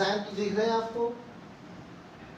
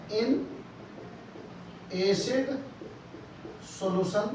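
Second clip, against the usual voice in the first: slow dictation; 5 dB quieter, much slower than usual. Shown as a demonstration. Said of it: "लिख रहा हूं मैं".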